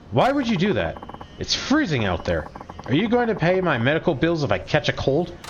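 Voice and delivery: annoyed passenger. Acted. Irate taxi passenger complaining.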